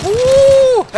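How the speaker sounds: high yelp into a shouted laugh; a startled cry breaking into laughter; surprised yelp. Real, not acted. Hit by a surprise shot.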